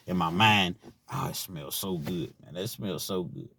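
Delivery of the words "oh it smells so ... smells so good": quiet mock longing; soft craving. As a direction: savour the smell in your head. Inner voice craving.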